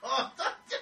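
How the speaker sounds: being tickled. Real, not acted.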